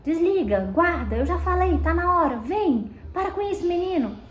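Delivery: put-on bossing the child around; authoritarian scolding. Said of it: "sendo autoritário e dizendo assim". (pai autoritário dando bronca, voz mais alta)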